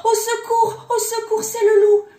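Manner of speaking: very high, loud call for help; panicked cry. A narrator's reading. Kids discovering the wolf inside, high panicked shout. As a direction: scream out in alarm like terrified little kids. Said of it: "et ils s'écrièrent".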